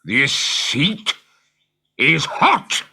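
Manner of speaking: pirate voice rising in alarm; Hook, growing alarmed. Acted. Hook notices heat.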